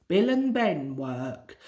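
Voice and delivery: plain reading voice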